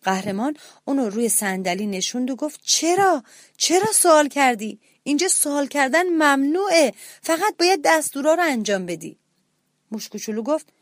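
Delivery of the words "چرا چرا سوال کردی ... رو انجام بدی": higher, urgent scolding; scolding hero mouse. A narrator's reading. Hero mouse scolding the little mouse for asking. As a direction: tell him off in alarm.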